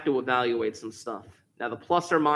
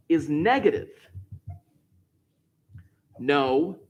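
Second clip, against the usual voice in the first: ordinary talk, nothing marked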